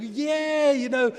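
high, bright-eyed joy at daddy coming home; gleeful children. Retold or imitated. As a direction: run to him squealing with delight. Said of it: "they must have run to him and said".

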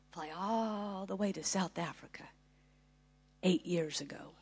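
unvoiced soft whisper. Real, not acted. Speaker drops to a whisper telling the audience, unvoiced. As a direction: breathe it out as an aside.

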